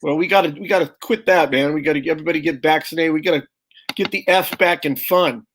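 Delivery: ordinary delivery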